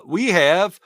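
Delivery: high, loud, slow proud reveal; excited announcement. Real, not acted. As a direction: announce it like a coup. Announcing the big guest.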